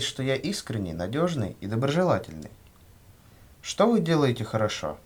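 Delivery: ordinary talk, nothing marked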